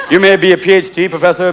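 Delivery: ordinary talk, nothing marked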